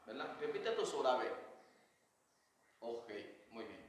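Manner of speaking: ordinary talk, nothing marked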